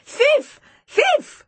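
repeated high, loud shouts; very high, loud yelling; shrill cry. A narrator's reading. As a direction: chant the accusation in piercing child's cries. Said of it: "It was a shrill cry".

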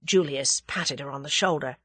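ordinary delivery

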